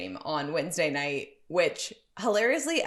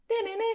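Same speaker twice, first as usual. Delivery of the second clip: high alert jingle; 13 semitones higher, faster than usual. Retold or imitated. Imitating the app alert sound.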